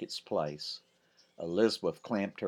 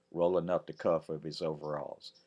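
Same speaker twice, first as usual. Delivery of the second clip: ordinary delivery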